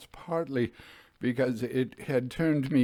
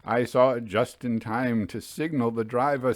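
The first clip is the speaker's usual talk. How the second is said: ordinary delivery, nothing marked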